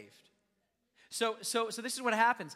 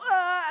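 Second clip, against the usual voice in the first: high, loud mock sobbing wail; ugly cry wail; 12 semitones higher, 12 dB louder than usual. Preacher imitating an ugly cry, mock wailing. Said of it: "where your face is all".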